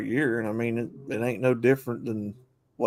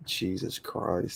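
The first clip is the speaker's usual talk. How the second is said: whispered; 4 semitones lower, 9 dB quieter, much slower than usual. Real, not acted. Exasperated aside.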